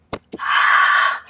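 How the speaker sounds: long hard breath out on exertion; forceful exhale. Real, not acted. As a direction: blow the air out sharply with effort. Exhaling through exercise reps.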